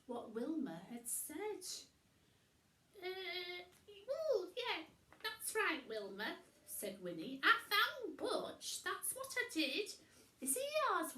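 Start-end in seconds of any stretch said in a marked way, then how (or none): 3.0-6.4 high worried witch; Winnie voice. A narrator's reading. Winnie covering up. 7.4-11.2 high, loud fretting witch voice; high worried witch; Winnie voice. A narrator's reading. Winnie bluffing.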